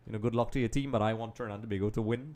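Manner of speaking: ordinary delivery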